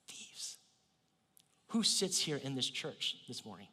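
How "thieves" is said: hushed whisper of a single word; whispered Thieves; whispered reveal. Acted. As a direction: drop to a whisper to let it land. Dramatic reveal to the congregation.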